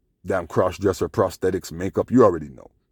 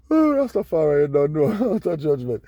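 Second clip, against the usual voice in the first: high words squeezed through laughter; helpless laughter; 8 semitones higher than usual. Real, not acted. Man cracking up at his own celebrity theory, laughing.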